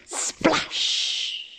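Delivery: soft, hushed splash with a long "sh"; breath-only, drawn-out splash; splash effect. A narrator's reading. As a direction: make "splash" sound like spraying water. Acts out the splash.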